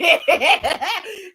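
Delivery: a loud, high burst of laughter; laughing. Real, not acted. Reviewer laughing at the bone marrow scene, loud laugh. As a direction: crack up at the scene.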